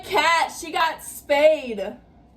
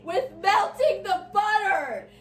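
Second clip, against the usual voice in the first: temporarily deafened; 6 semitones higher, slower than usual. Real, not acted. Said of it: "I can't hear anything".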